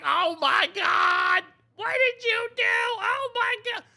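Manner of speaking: high, loud panicked shriek; mock freak-out. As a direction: shriek it like she's horrified. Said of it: "she freaks out. She's like".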